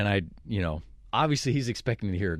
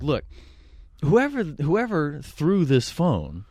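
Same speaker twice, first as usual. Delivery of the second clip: raised, deliberate mock-police voice; Officer Conway; 6 semitones higher, 4 dB louder than usual. Fake officer confronts the harassing caller, pointed and official. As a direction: imitate a cop laying out an accusation. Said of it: "I used Officer Conway".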